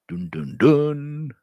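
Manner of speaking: slow, drawn-out suspense sting; hammy soap-opera cliffhanger sting; dramatic dun-dun-dun. Pretend. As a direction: intone a mock ominous musical sting. Mock suspense sting after grim line.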